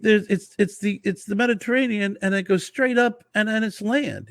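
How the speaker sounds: high excited explaining voice; Italian guy explaining. Retold or imitated. Winery guy explaining Mediterranean geography, high and eager. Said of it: "He goes".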